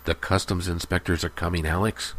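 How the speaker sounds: ordinary delivery, nothing marked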